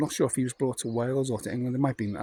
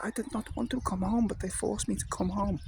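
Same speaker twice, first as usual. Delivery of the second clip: put-on high, softer old-man voice; a quieter, high, rueful old man; old German man; 9 semitones higher, 4 dB quieter than usual. Old man telling the lads why he left, high soft voice.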